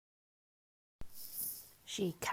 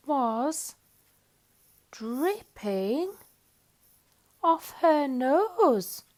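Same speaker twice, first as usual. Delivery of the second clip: very slow, stretched phrase; drawn out; 5 semitones higher, 4 dB louder, much slower than usual. A narrator's reading. Narrator describing rain dripping off Mog, slowly drawn out. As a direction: linger on each word.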